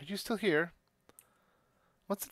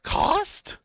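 shocked squeak; 14 semitones higher, 6 dB louder than usual. Acted. Shocked tiny shopkeeper.

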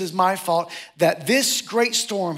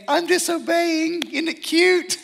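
mock cute; 9 semitones higher, slower than usual. Mocking cute excuse.

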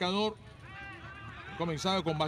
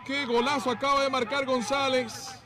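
long drawn-out goal call, high and loud; goal commentary; 8 semitones higher, 5 dB louder than usual. Real, not acted. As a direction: stretch out the goal cry.

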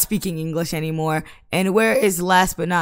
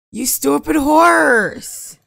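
higher, slow exasperated snap at the horse; higher and slow, fed up with the horse; annoyed yell; 6 semitones higher, 4 dB louder, much slower than usual. Real, not acted. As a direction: scold the horse, dragging it out. Yelling at game horse.

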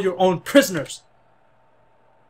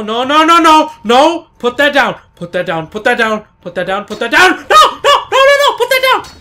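frantic, loud, higher shouts of no and put that down; panicked yelling; 6 semitones higher, 10 dB louder than usual. Real, not acted. Begging the guard to put the weapon down, panicked screams.

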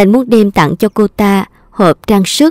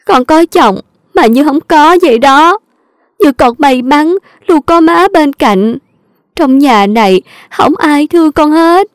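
higher, whimpering plea to her mother-in-law; mild fake tears, begging higher; crying while pleading; 7 semitones higher than usual. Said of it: "vừa khóc cô ta vừa nói".

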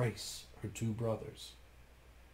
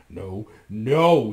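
louder refusal; firm refusal; 2 semitones lower, 9 dB louder than usual. A narrator's reading. Bluebeard refusing his begging wife. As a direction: cut her off sharply. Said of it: "he said".

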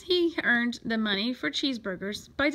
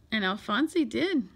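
plain reading voice

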